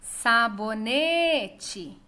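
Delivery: "Sabonete" called very slowly, NE drawn out; calling out the word. Shown as a demonstration. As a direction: call "Sabonete", holding the stressed NE long. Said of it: "esse exercício de chamar as palavras".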